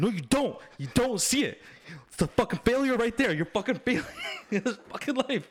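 high, loud, heated rant with a laugh breaking in; angry retold rant. Ranting at girlfriend glued to her phone, heated and loud. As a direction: rant at her like she's ruining the movie.

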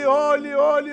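normal talking voice

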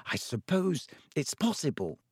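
soft, hesitant concession; cautious. A narrator's reading. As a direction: admit it warily, a little quietly. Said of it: "said Hermione cautiously".

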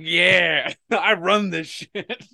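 high, slow, swaggering boast; cocky. Retold or imitated. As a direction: brag like you own the place. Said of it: "and I'm just like".

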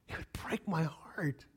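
slow, soft, half-whispered; hushed heartache. Real, not acted. Father imagining son earning his love, hushed hurt. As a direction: let it sink almost to a whisper.

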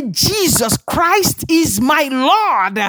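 high, slow declaration of faith; emphatic declaration. Real, not acted. Preacher leading the congregation's confession, high drawn-out proclamation. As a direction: proclaim it slowly with each word stretched.

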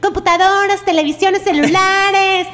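crackly looped vendor recording. Retold or imitated. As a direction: call it out like a recorded truck. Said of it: "como una grabación en medio".

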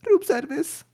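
put-on very high, loud Mexican maid accent; hammed-up maid accent, calling out at the door; mock maid accent. Podcaster mocking his own failed accent, high Mexican maid voice. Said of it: "I sound like I should be saying".

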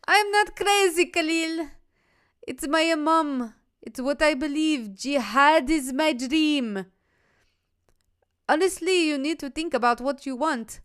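a podcaster voicing Jana's messages in a high, mock-accented voice; put-on young woman voice. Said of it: "do the accent".

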